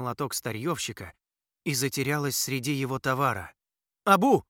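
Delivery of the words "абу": loud high call; shouting. A narrator's reading. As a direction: yell the name across. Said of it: "крикнул парень обезьянке".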